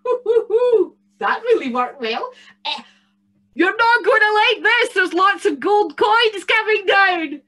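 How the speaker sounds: high, loud gleeful goblin voice; a bogle delighted his trick works; gloating bogle. Acted. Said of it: "thought the bogle".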